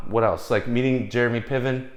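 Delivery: ordinary talk, nothing marked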